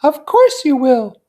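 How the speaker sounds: put-on high young woman voice; Daisy voice. A narrator's reading. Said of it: "confirmed Daisy".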